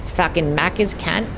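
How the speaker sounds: mock Aussie ocker. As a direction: play the crude Aussie bloke. Mocking Aussie slang.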